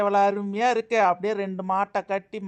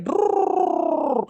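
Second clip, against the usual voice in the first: long drawn-out higher "ட்ரூர்" rolled to urge the bullocks; drawn-out bullock-cart call; cart driver's call; 9 semitones higher, much slower than usual. Acted. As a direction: call the bulls to go, stretching it out.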